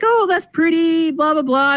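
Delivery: light mock grandma fuss; doting grandparents. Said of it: "And they're like".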